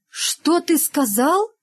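high, slow, shocked question; scandalised teacher, pitch rising high; aghast. A narrator's reading. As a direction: gasp it out slowly in disbelief. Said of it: "ахнула Изабелла Михайловна".

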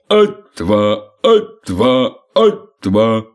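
loud drill count, clipped beats; marching command. Acted. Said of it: "скомандовал". (громкий счёт строевого шага)

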